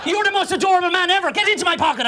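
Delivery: high-pitched adoring gush; hammed-up, high squeal of affection; gushing delight. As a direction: coo over someone unbearably adorable. Gushing at adorable taxi driver.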